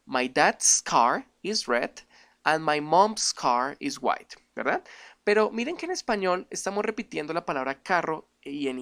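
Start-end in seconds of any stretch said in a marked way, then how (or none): 0.1-4.4 very slow, careful English sentence; slow English demo. Shown as a demonstration. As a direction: model it slowly for learners. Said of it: "esto sería".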